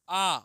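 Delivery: आ held long and loud; long vowel demo. Shown as a demonstration. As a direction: stretch आ so its length is heard. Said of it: "देखिए लग रहा है ना". (आ को लंबा खींचकर ज़ोर से)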